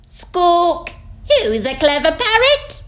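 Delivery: very high, loud parrot mimicry; squawk, then parrot the phrase back; copying parrot. A narrator's reading. Polly copies Granny's praise, high parrot voice. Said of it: "Polly copied".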